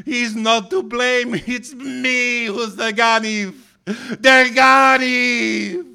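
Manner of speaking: high, anguished full-voiced shout; crying out. A narrator's reading. Feverish child confesses the theft in delirium, high desperate cry. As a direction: cry it out desperately, dragging the final words into a wail. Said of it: "I cried".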